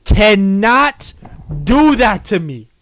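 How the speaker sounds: loud, high, protesting; startled shout. Real, not acted. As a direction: yell in shock. Startled by game scare.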